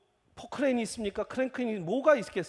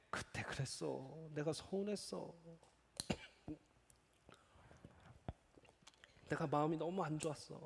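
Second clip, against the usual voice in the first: gentle confession; 4 semitones lower, 12 dB quieter than usual. Retold or imitated. Gently voicing hurt friend.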